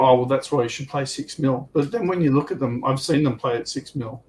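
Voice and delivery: ordinary talk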